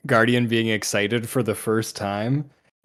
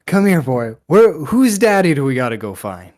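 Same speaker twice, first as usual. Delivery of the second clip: high, loud doggy talk; 5 semitones higher, 9 dB louder than usual. Acted. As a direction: coo at the dog like a pet owner. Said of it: "He's like".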